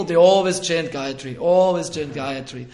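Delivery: always chant Gayatri, always punched loud; emphatic always chant Gayatri; stressed always. Retold or imitated.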